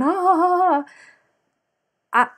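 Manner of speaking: high, loud playful boo cries; mock scare shout. Family jumping out in the dark, a high playful scare cry. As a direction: imitate family springing out to startle.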